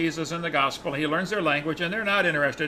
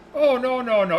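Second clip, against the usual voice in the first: loud, higher run of protests; retold emphatic denial; 6 semitones higher, 6 dB louder than usual. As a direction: reject the idea as shocking. Tribesman rejects the shocking suggestion.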